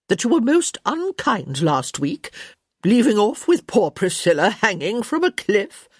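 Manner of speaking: high, emphatic countess scolding; sniffy reproach. A narrator's reading. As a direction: scold like an affronted elderly countess, punching words. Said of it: "Lady D said with a sniff".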